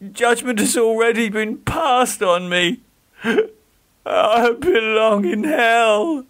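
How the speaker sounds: Geoffrey weeping that he is damned; despairing sobs. Acted. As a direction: sob out your guilt. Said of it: "shaken by sobs".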